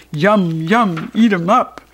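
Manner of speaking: hungry monster chant, lightly voiced; toilets bellowing. A narrator's reading.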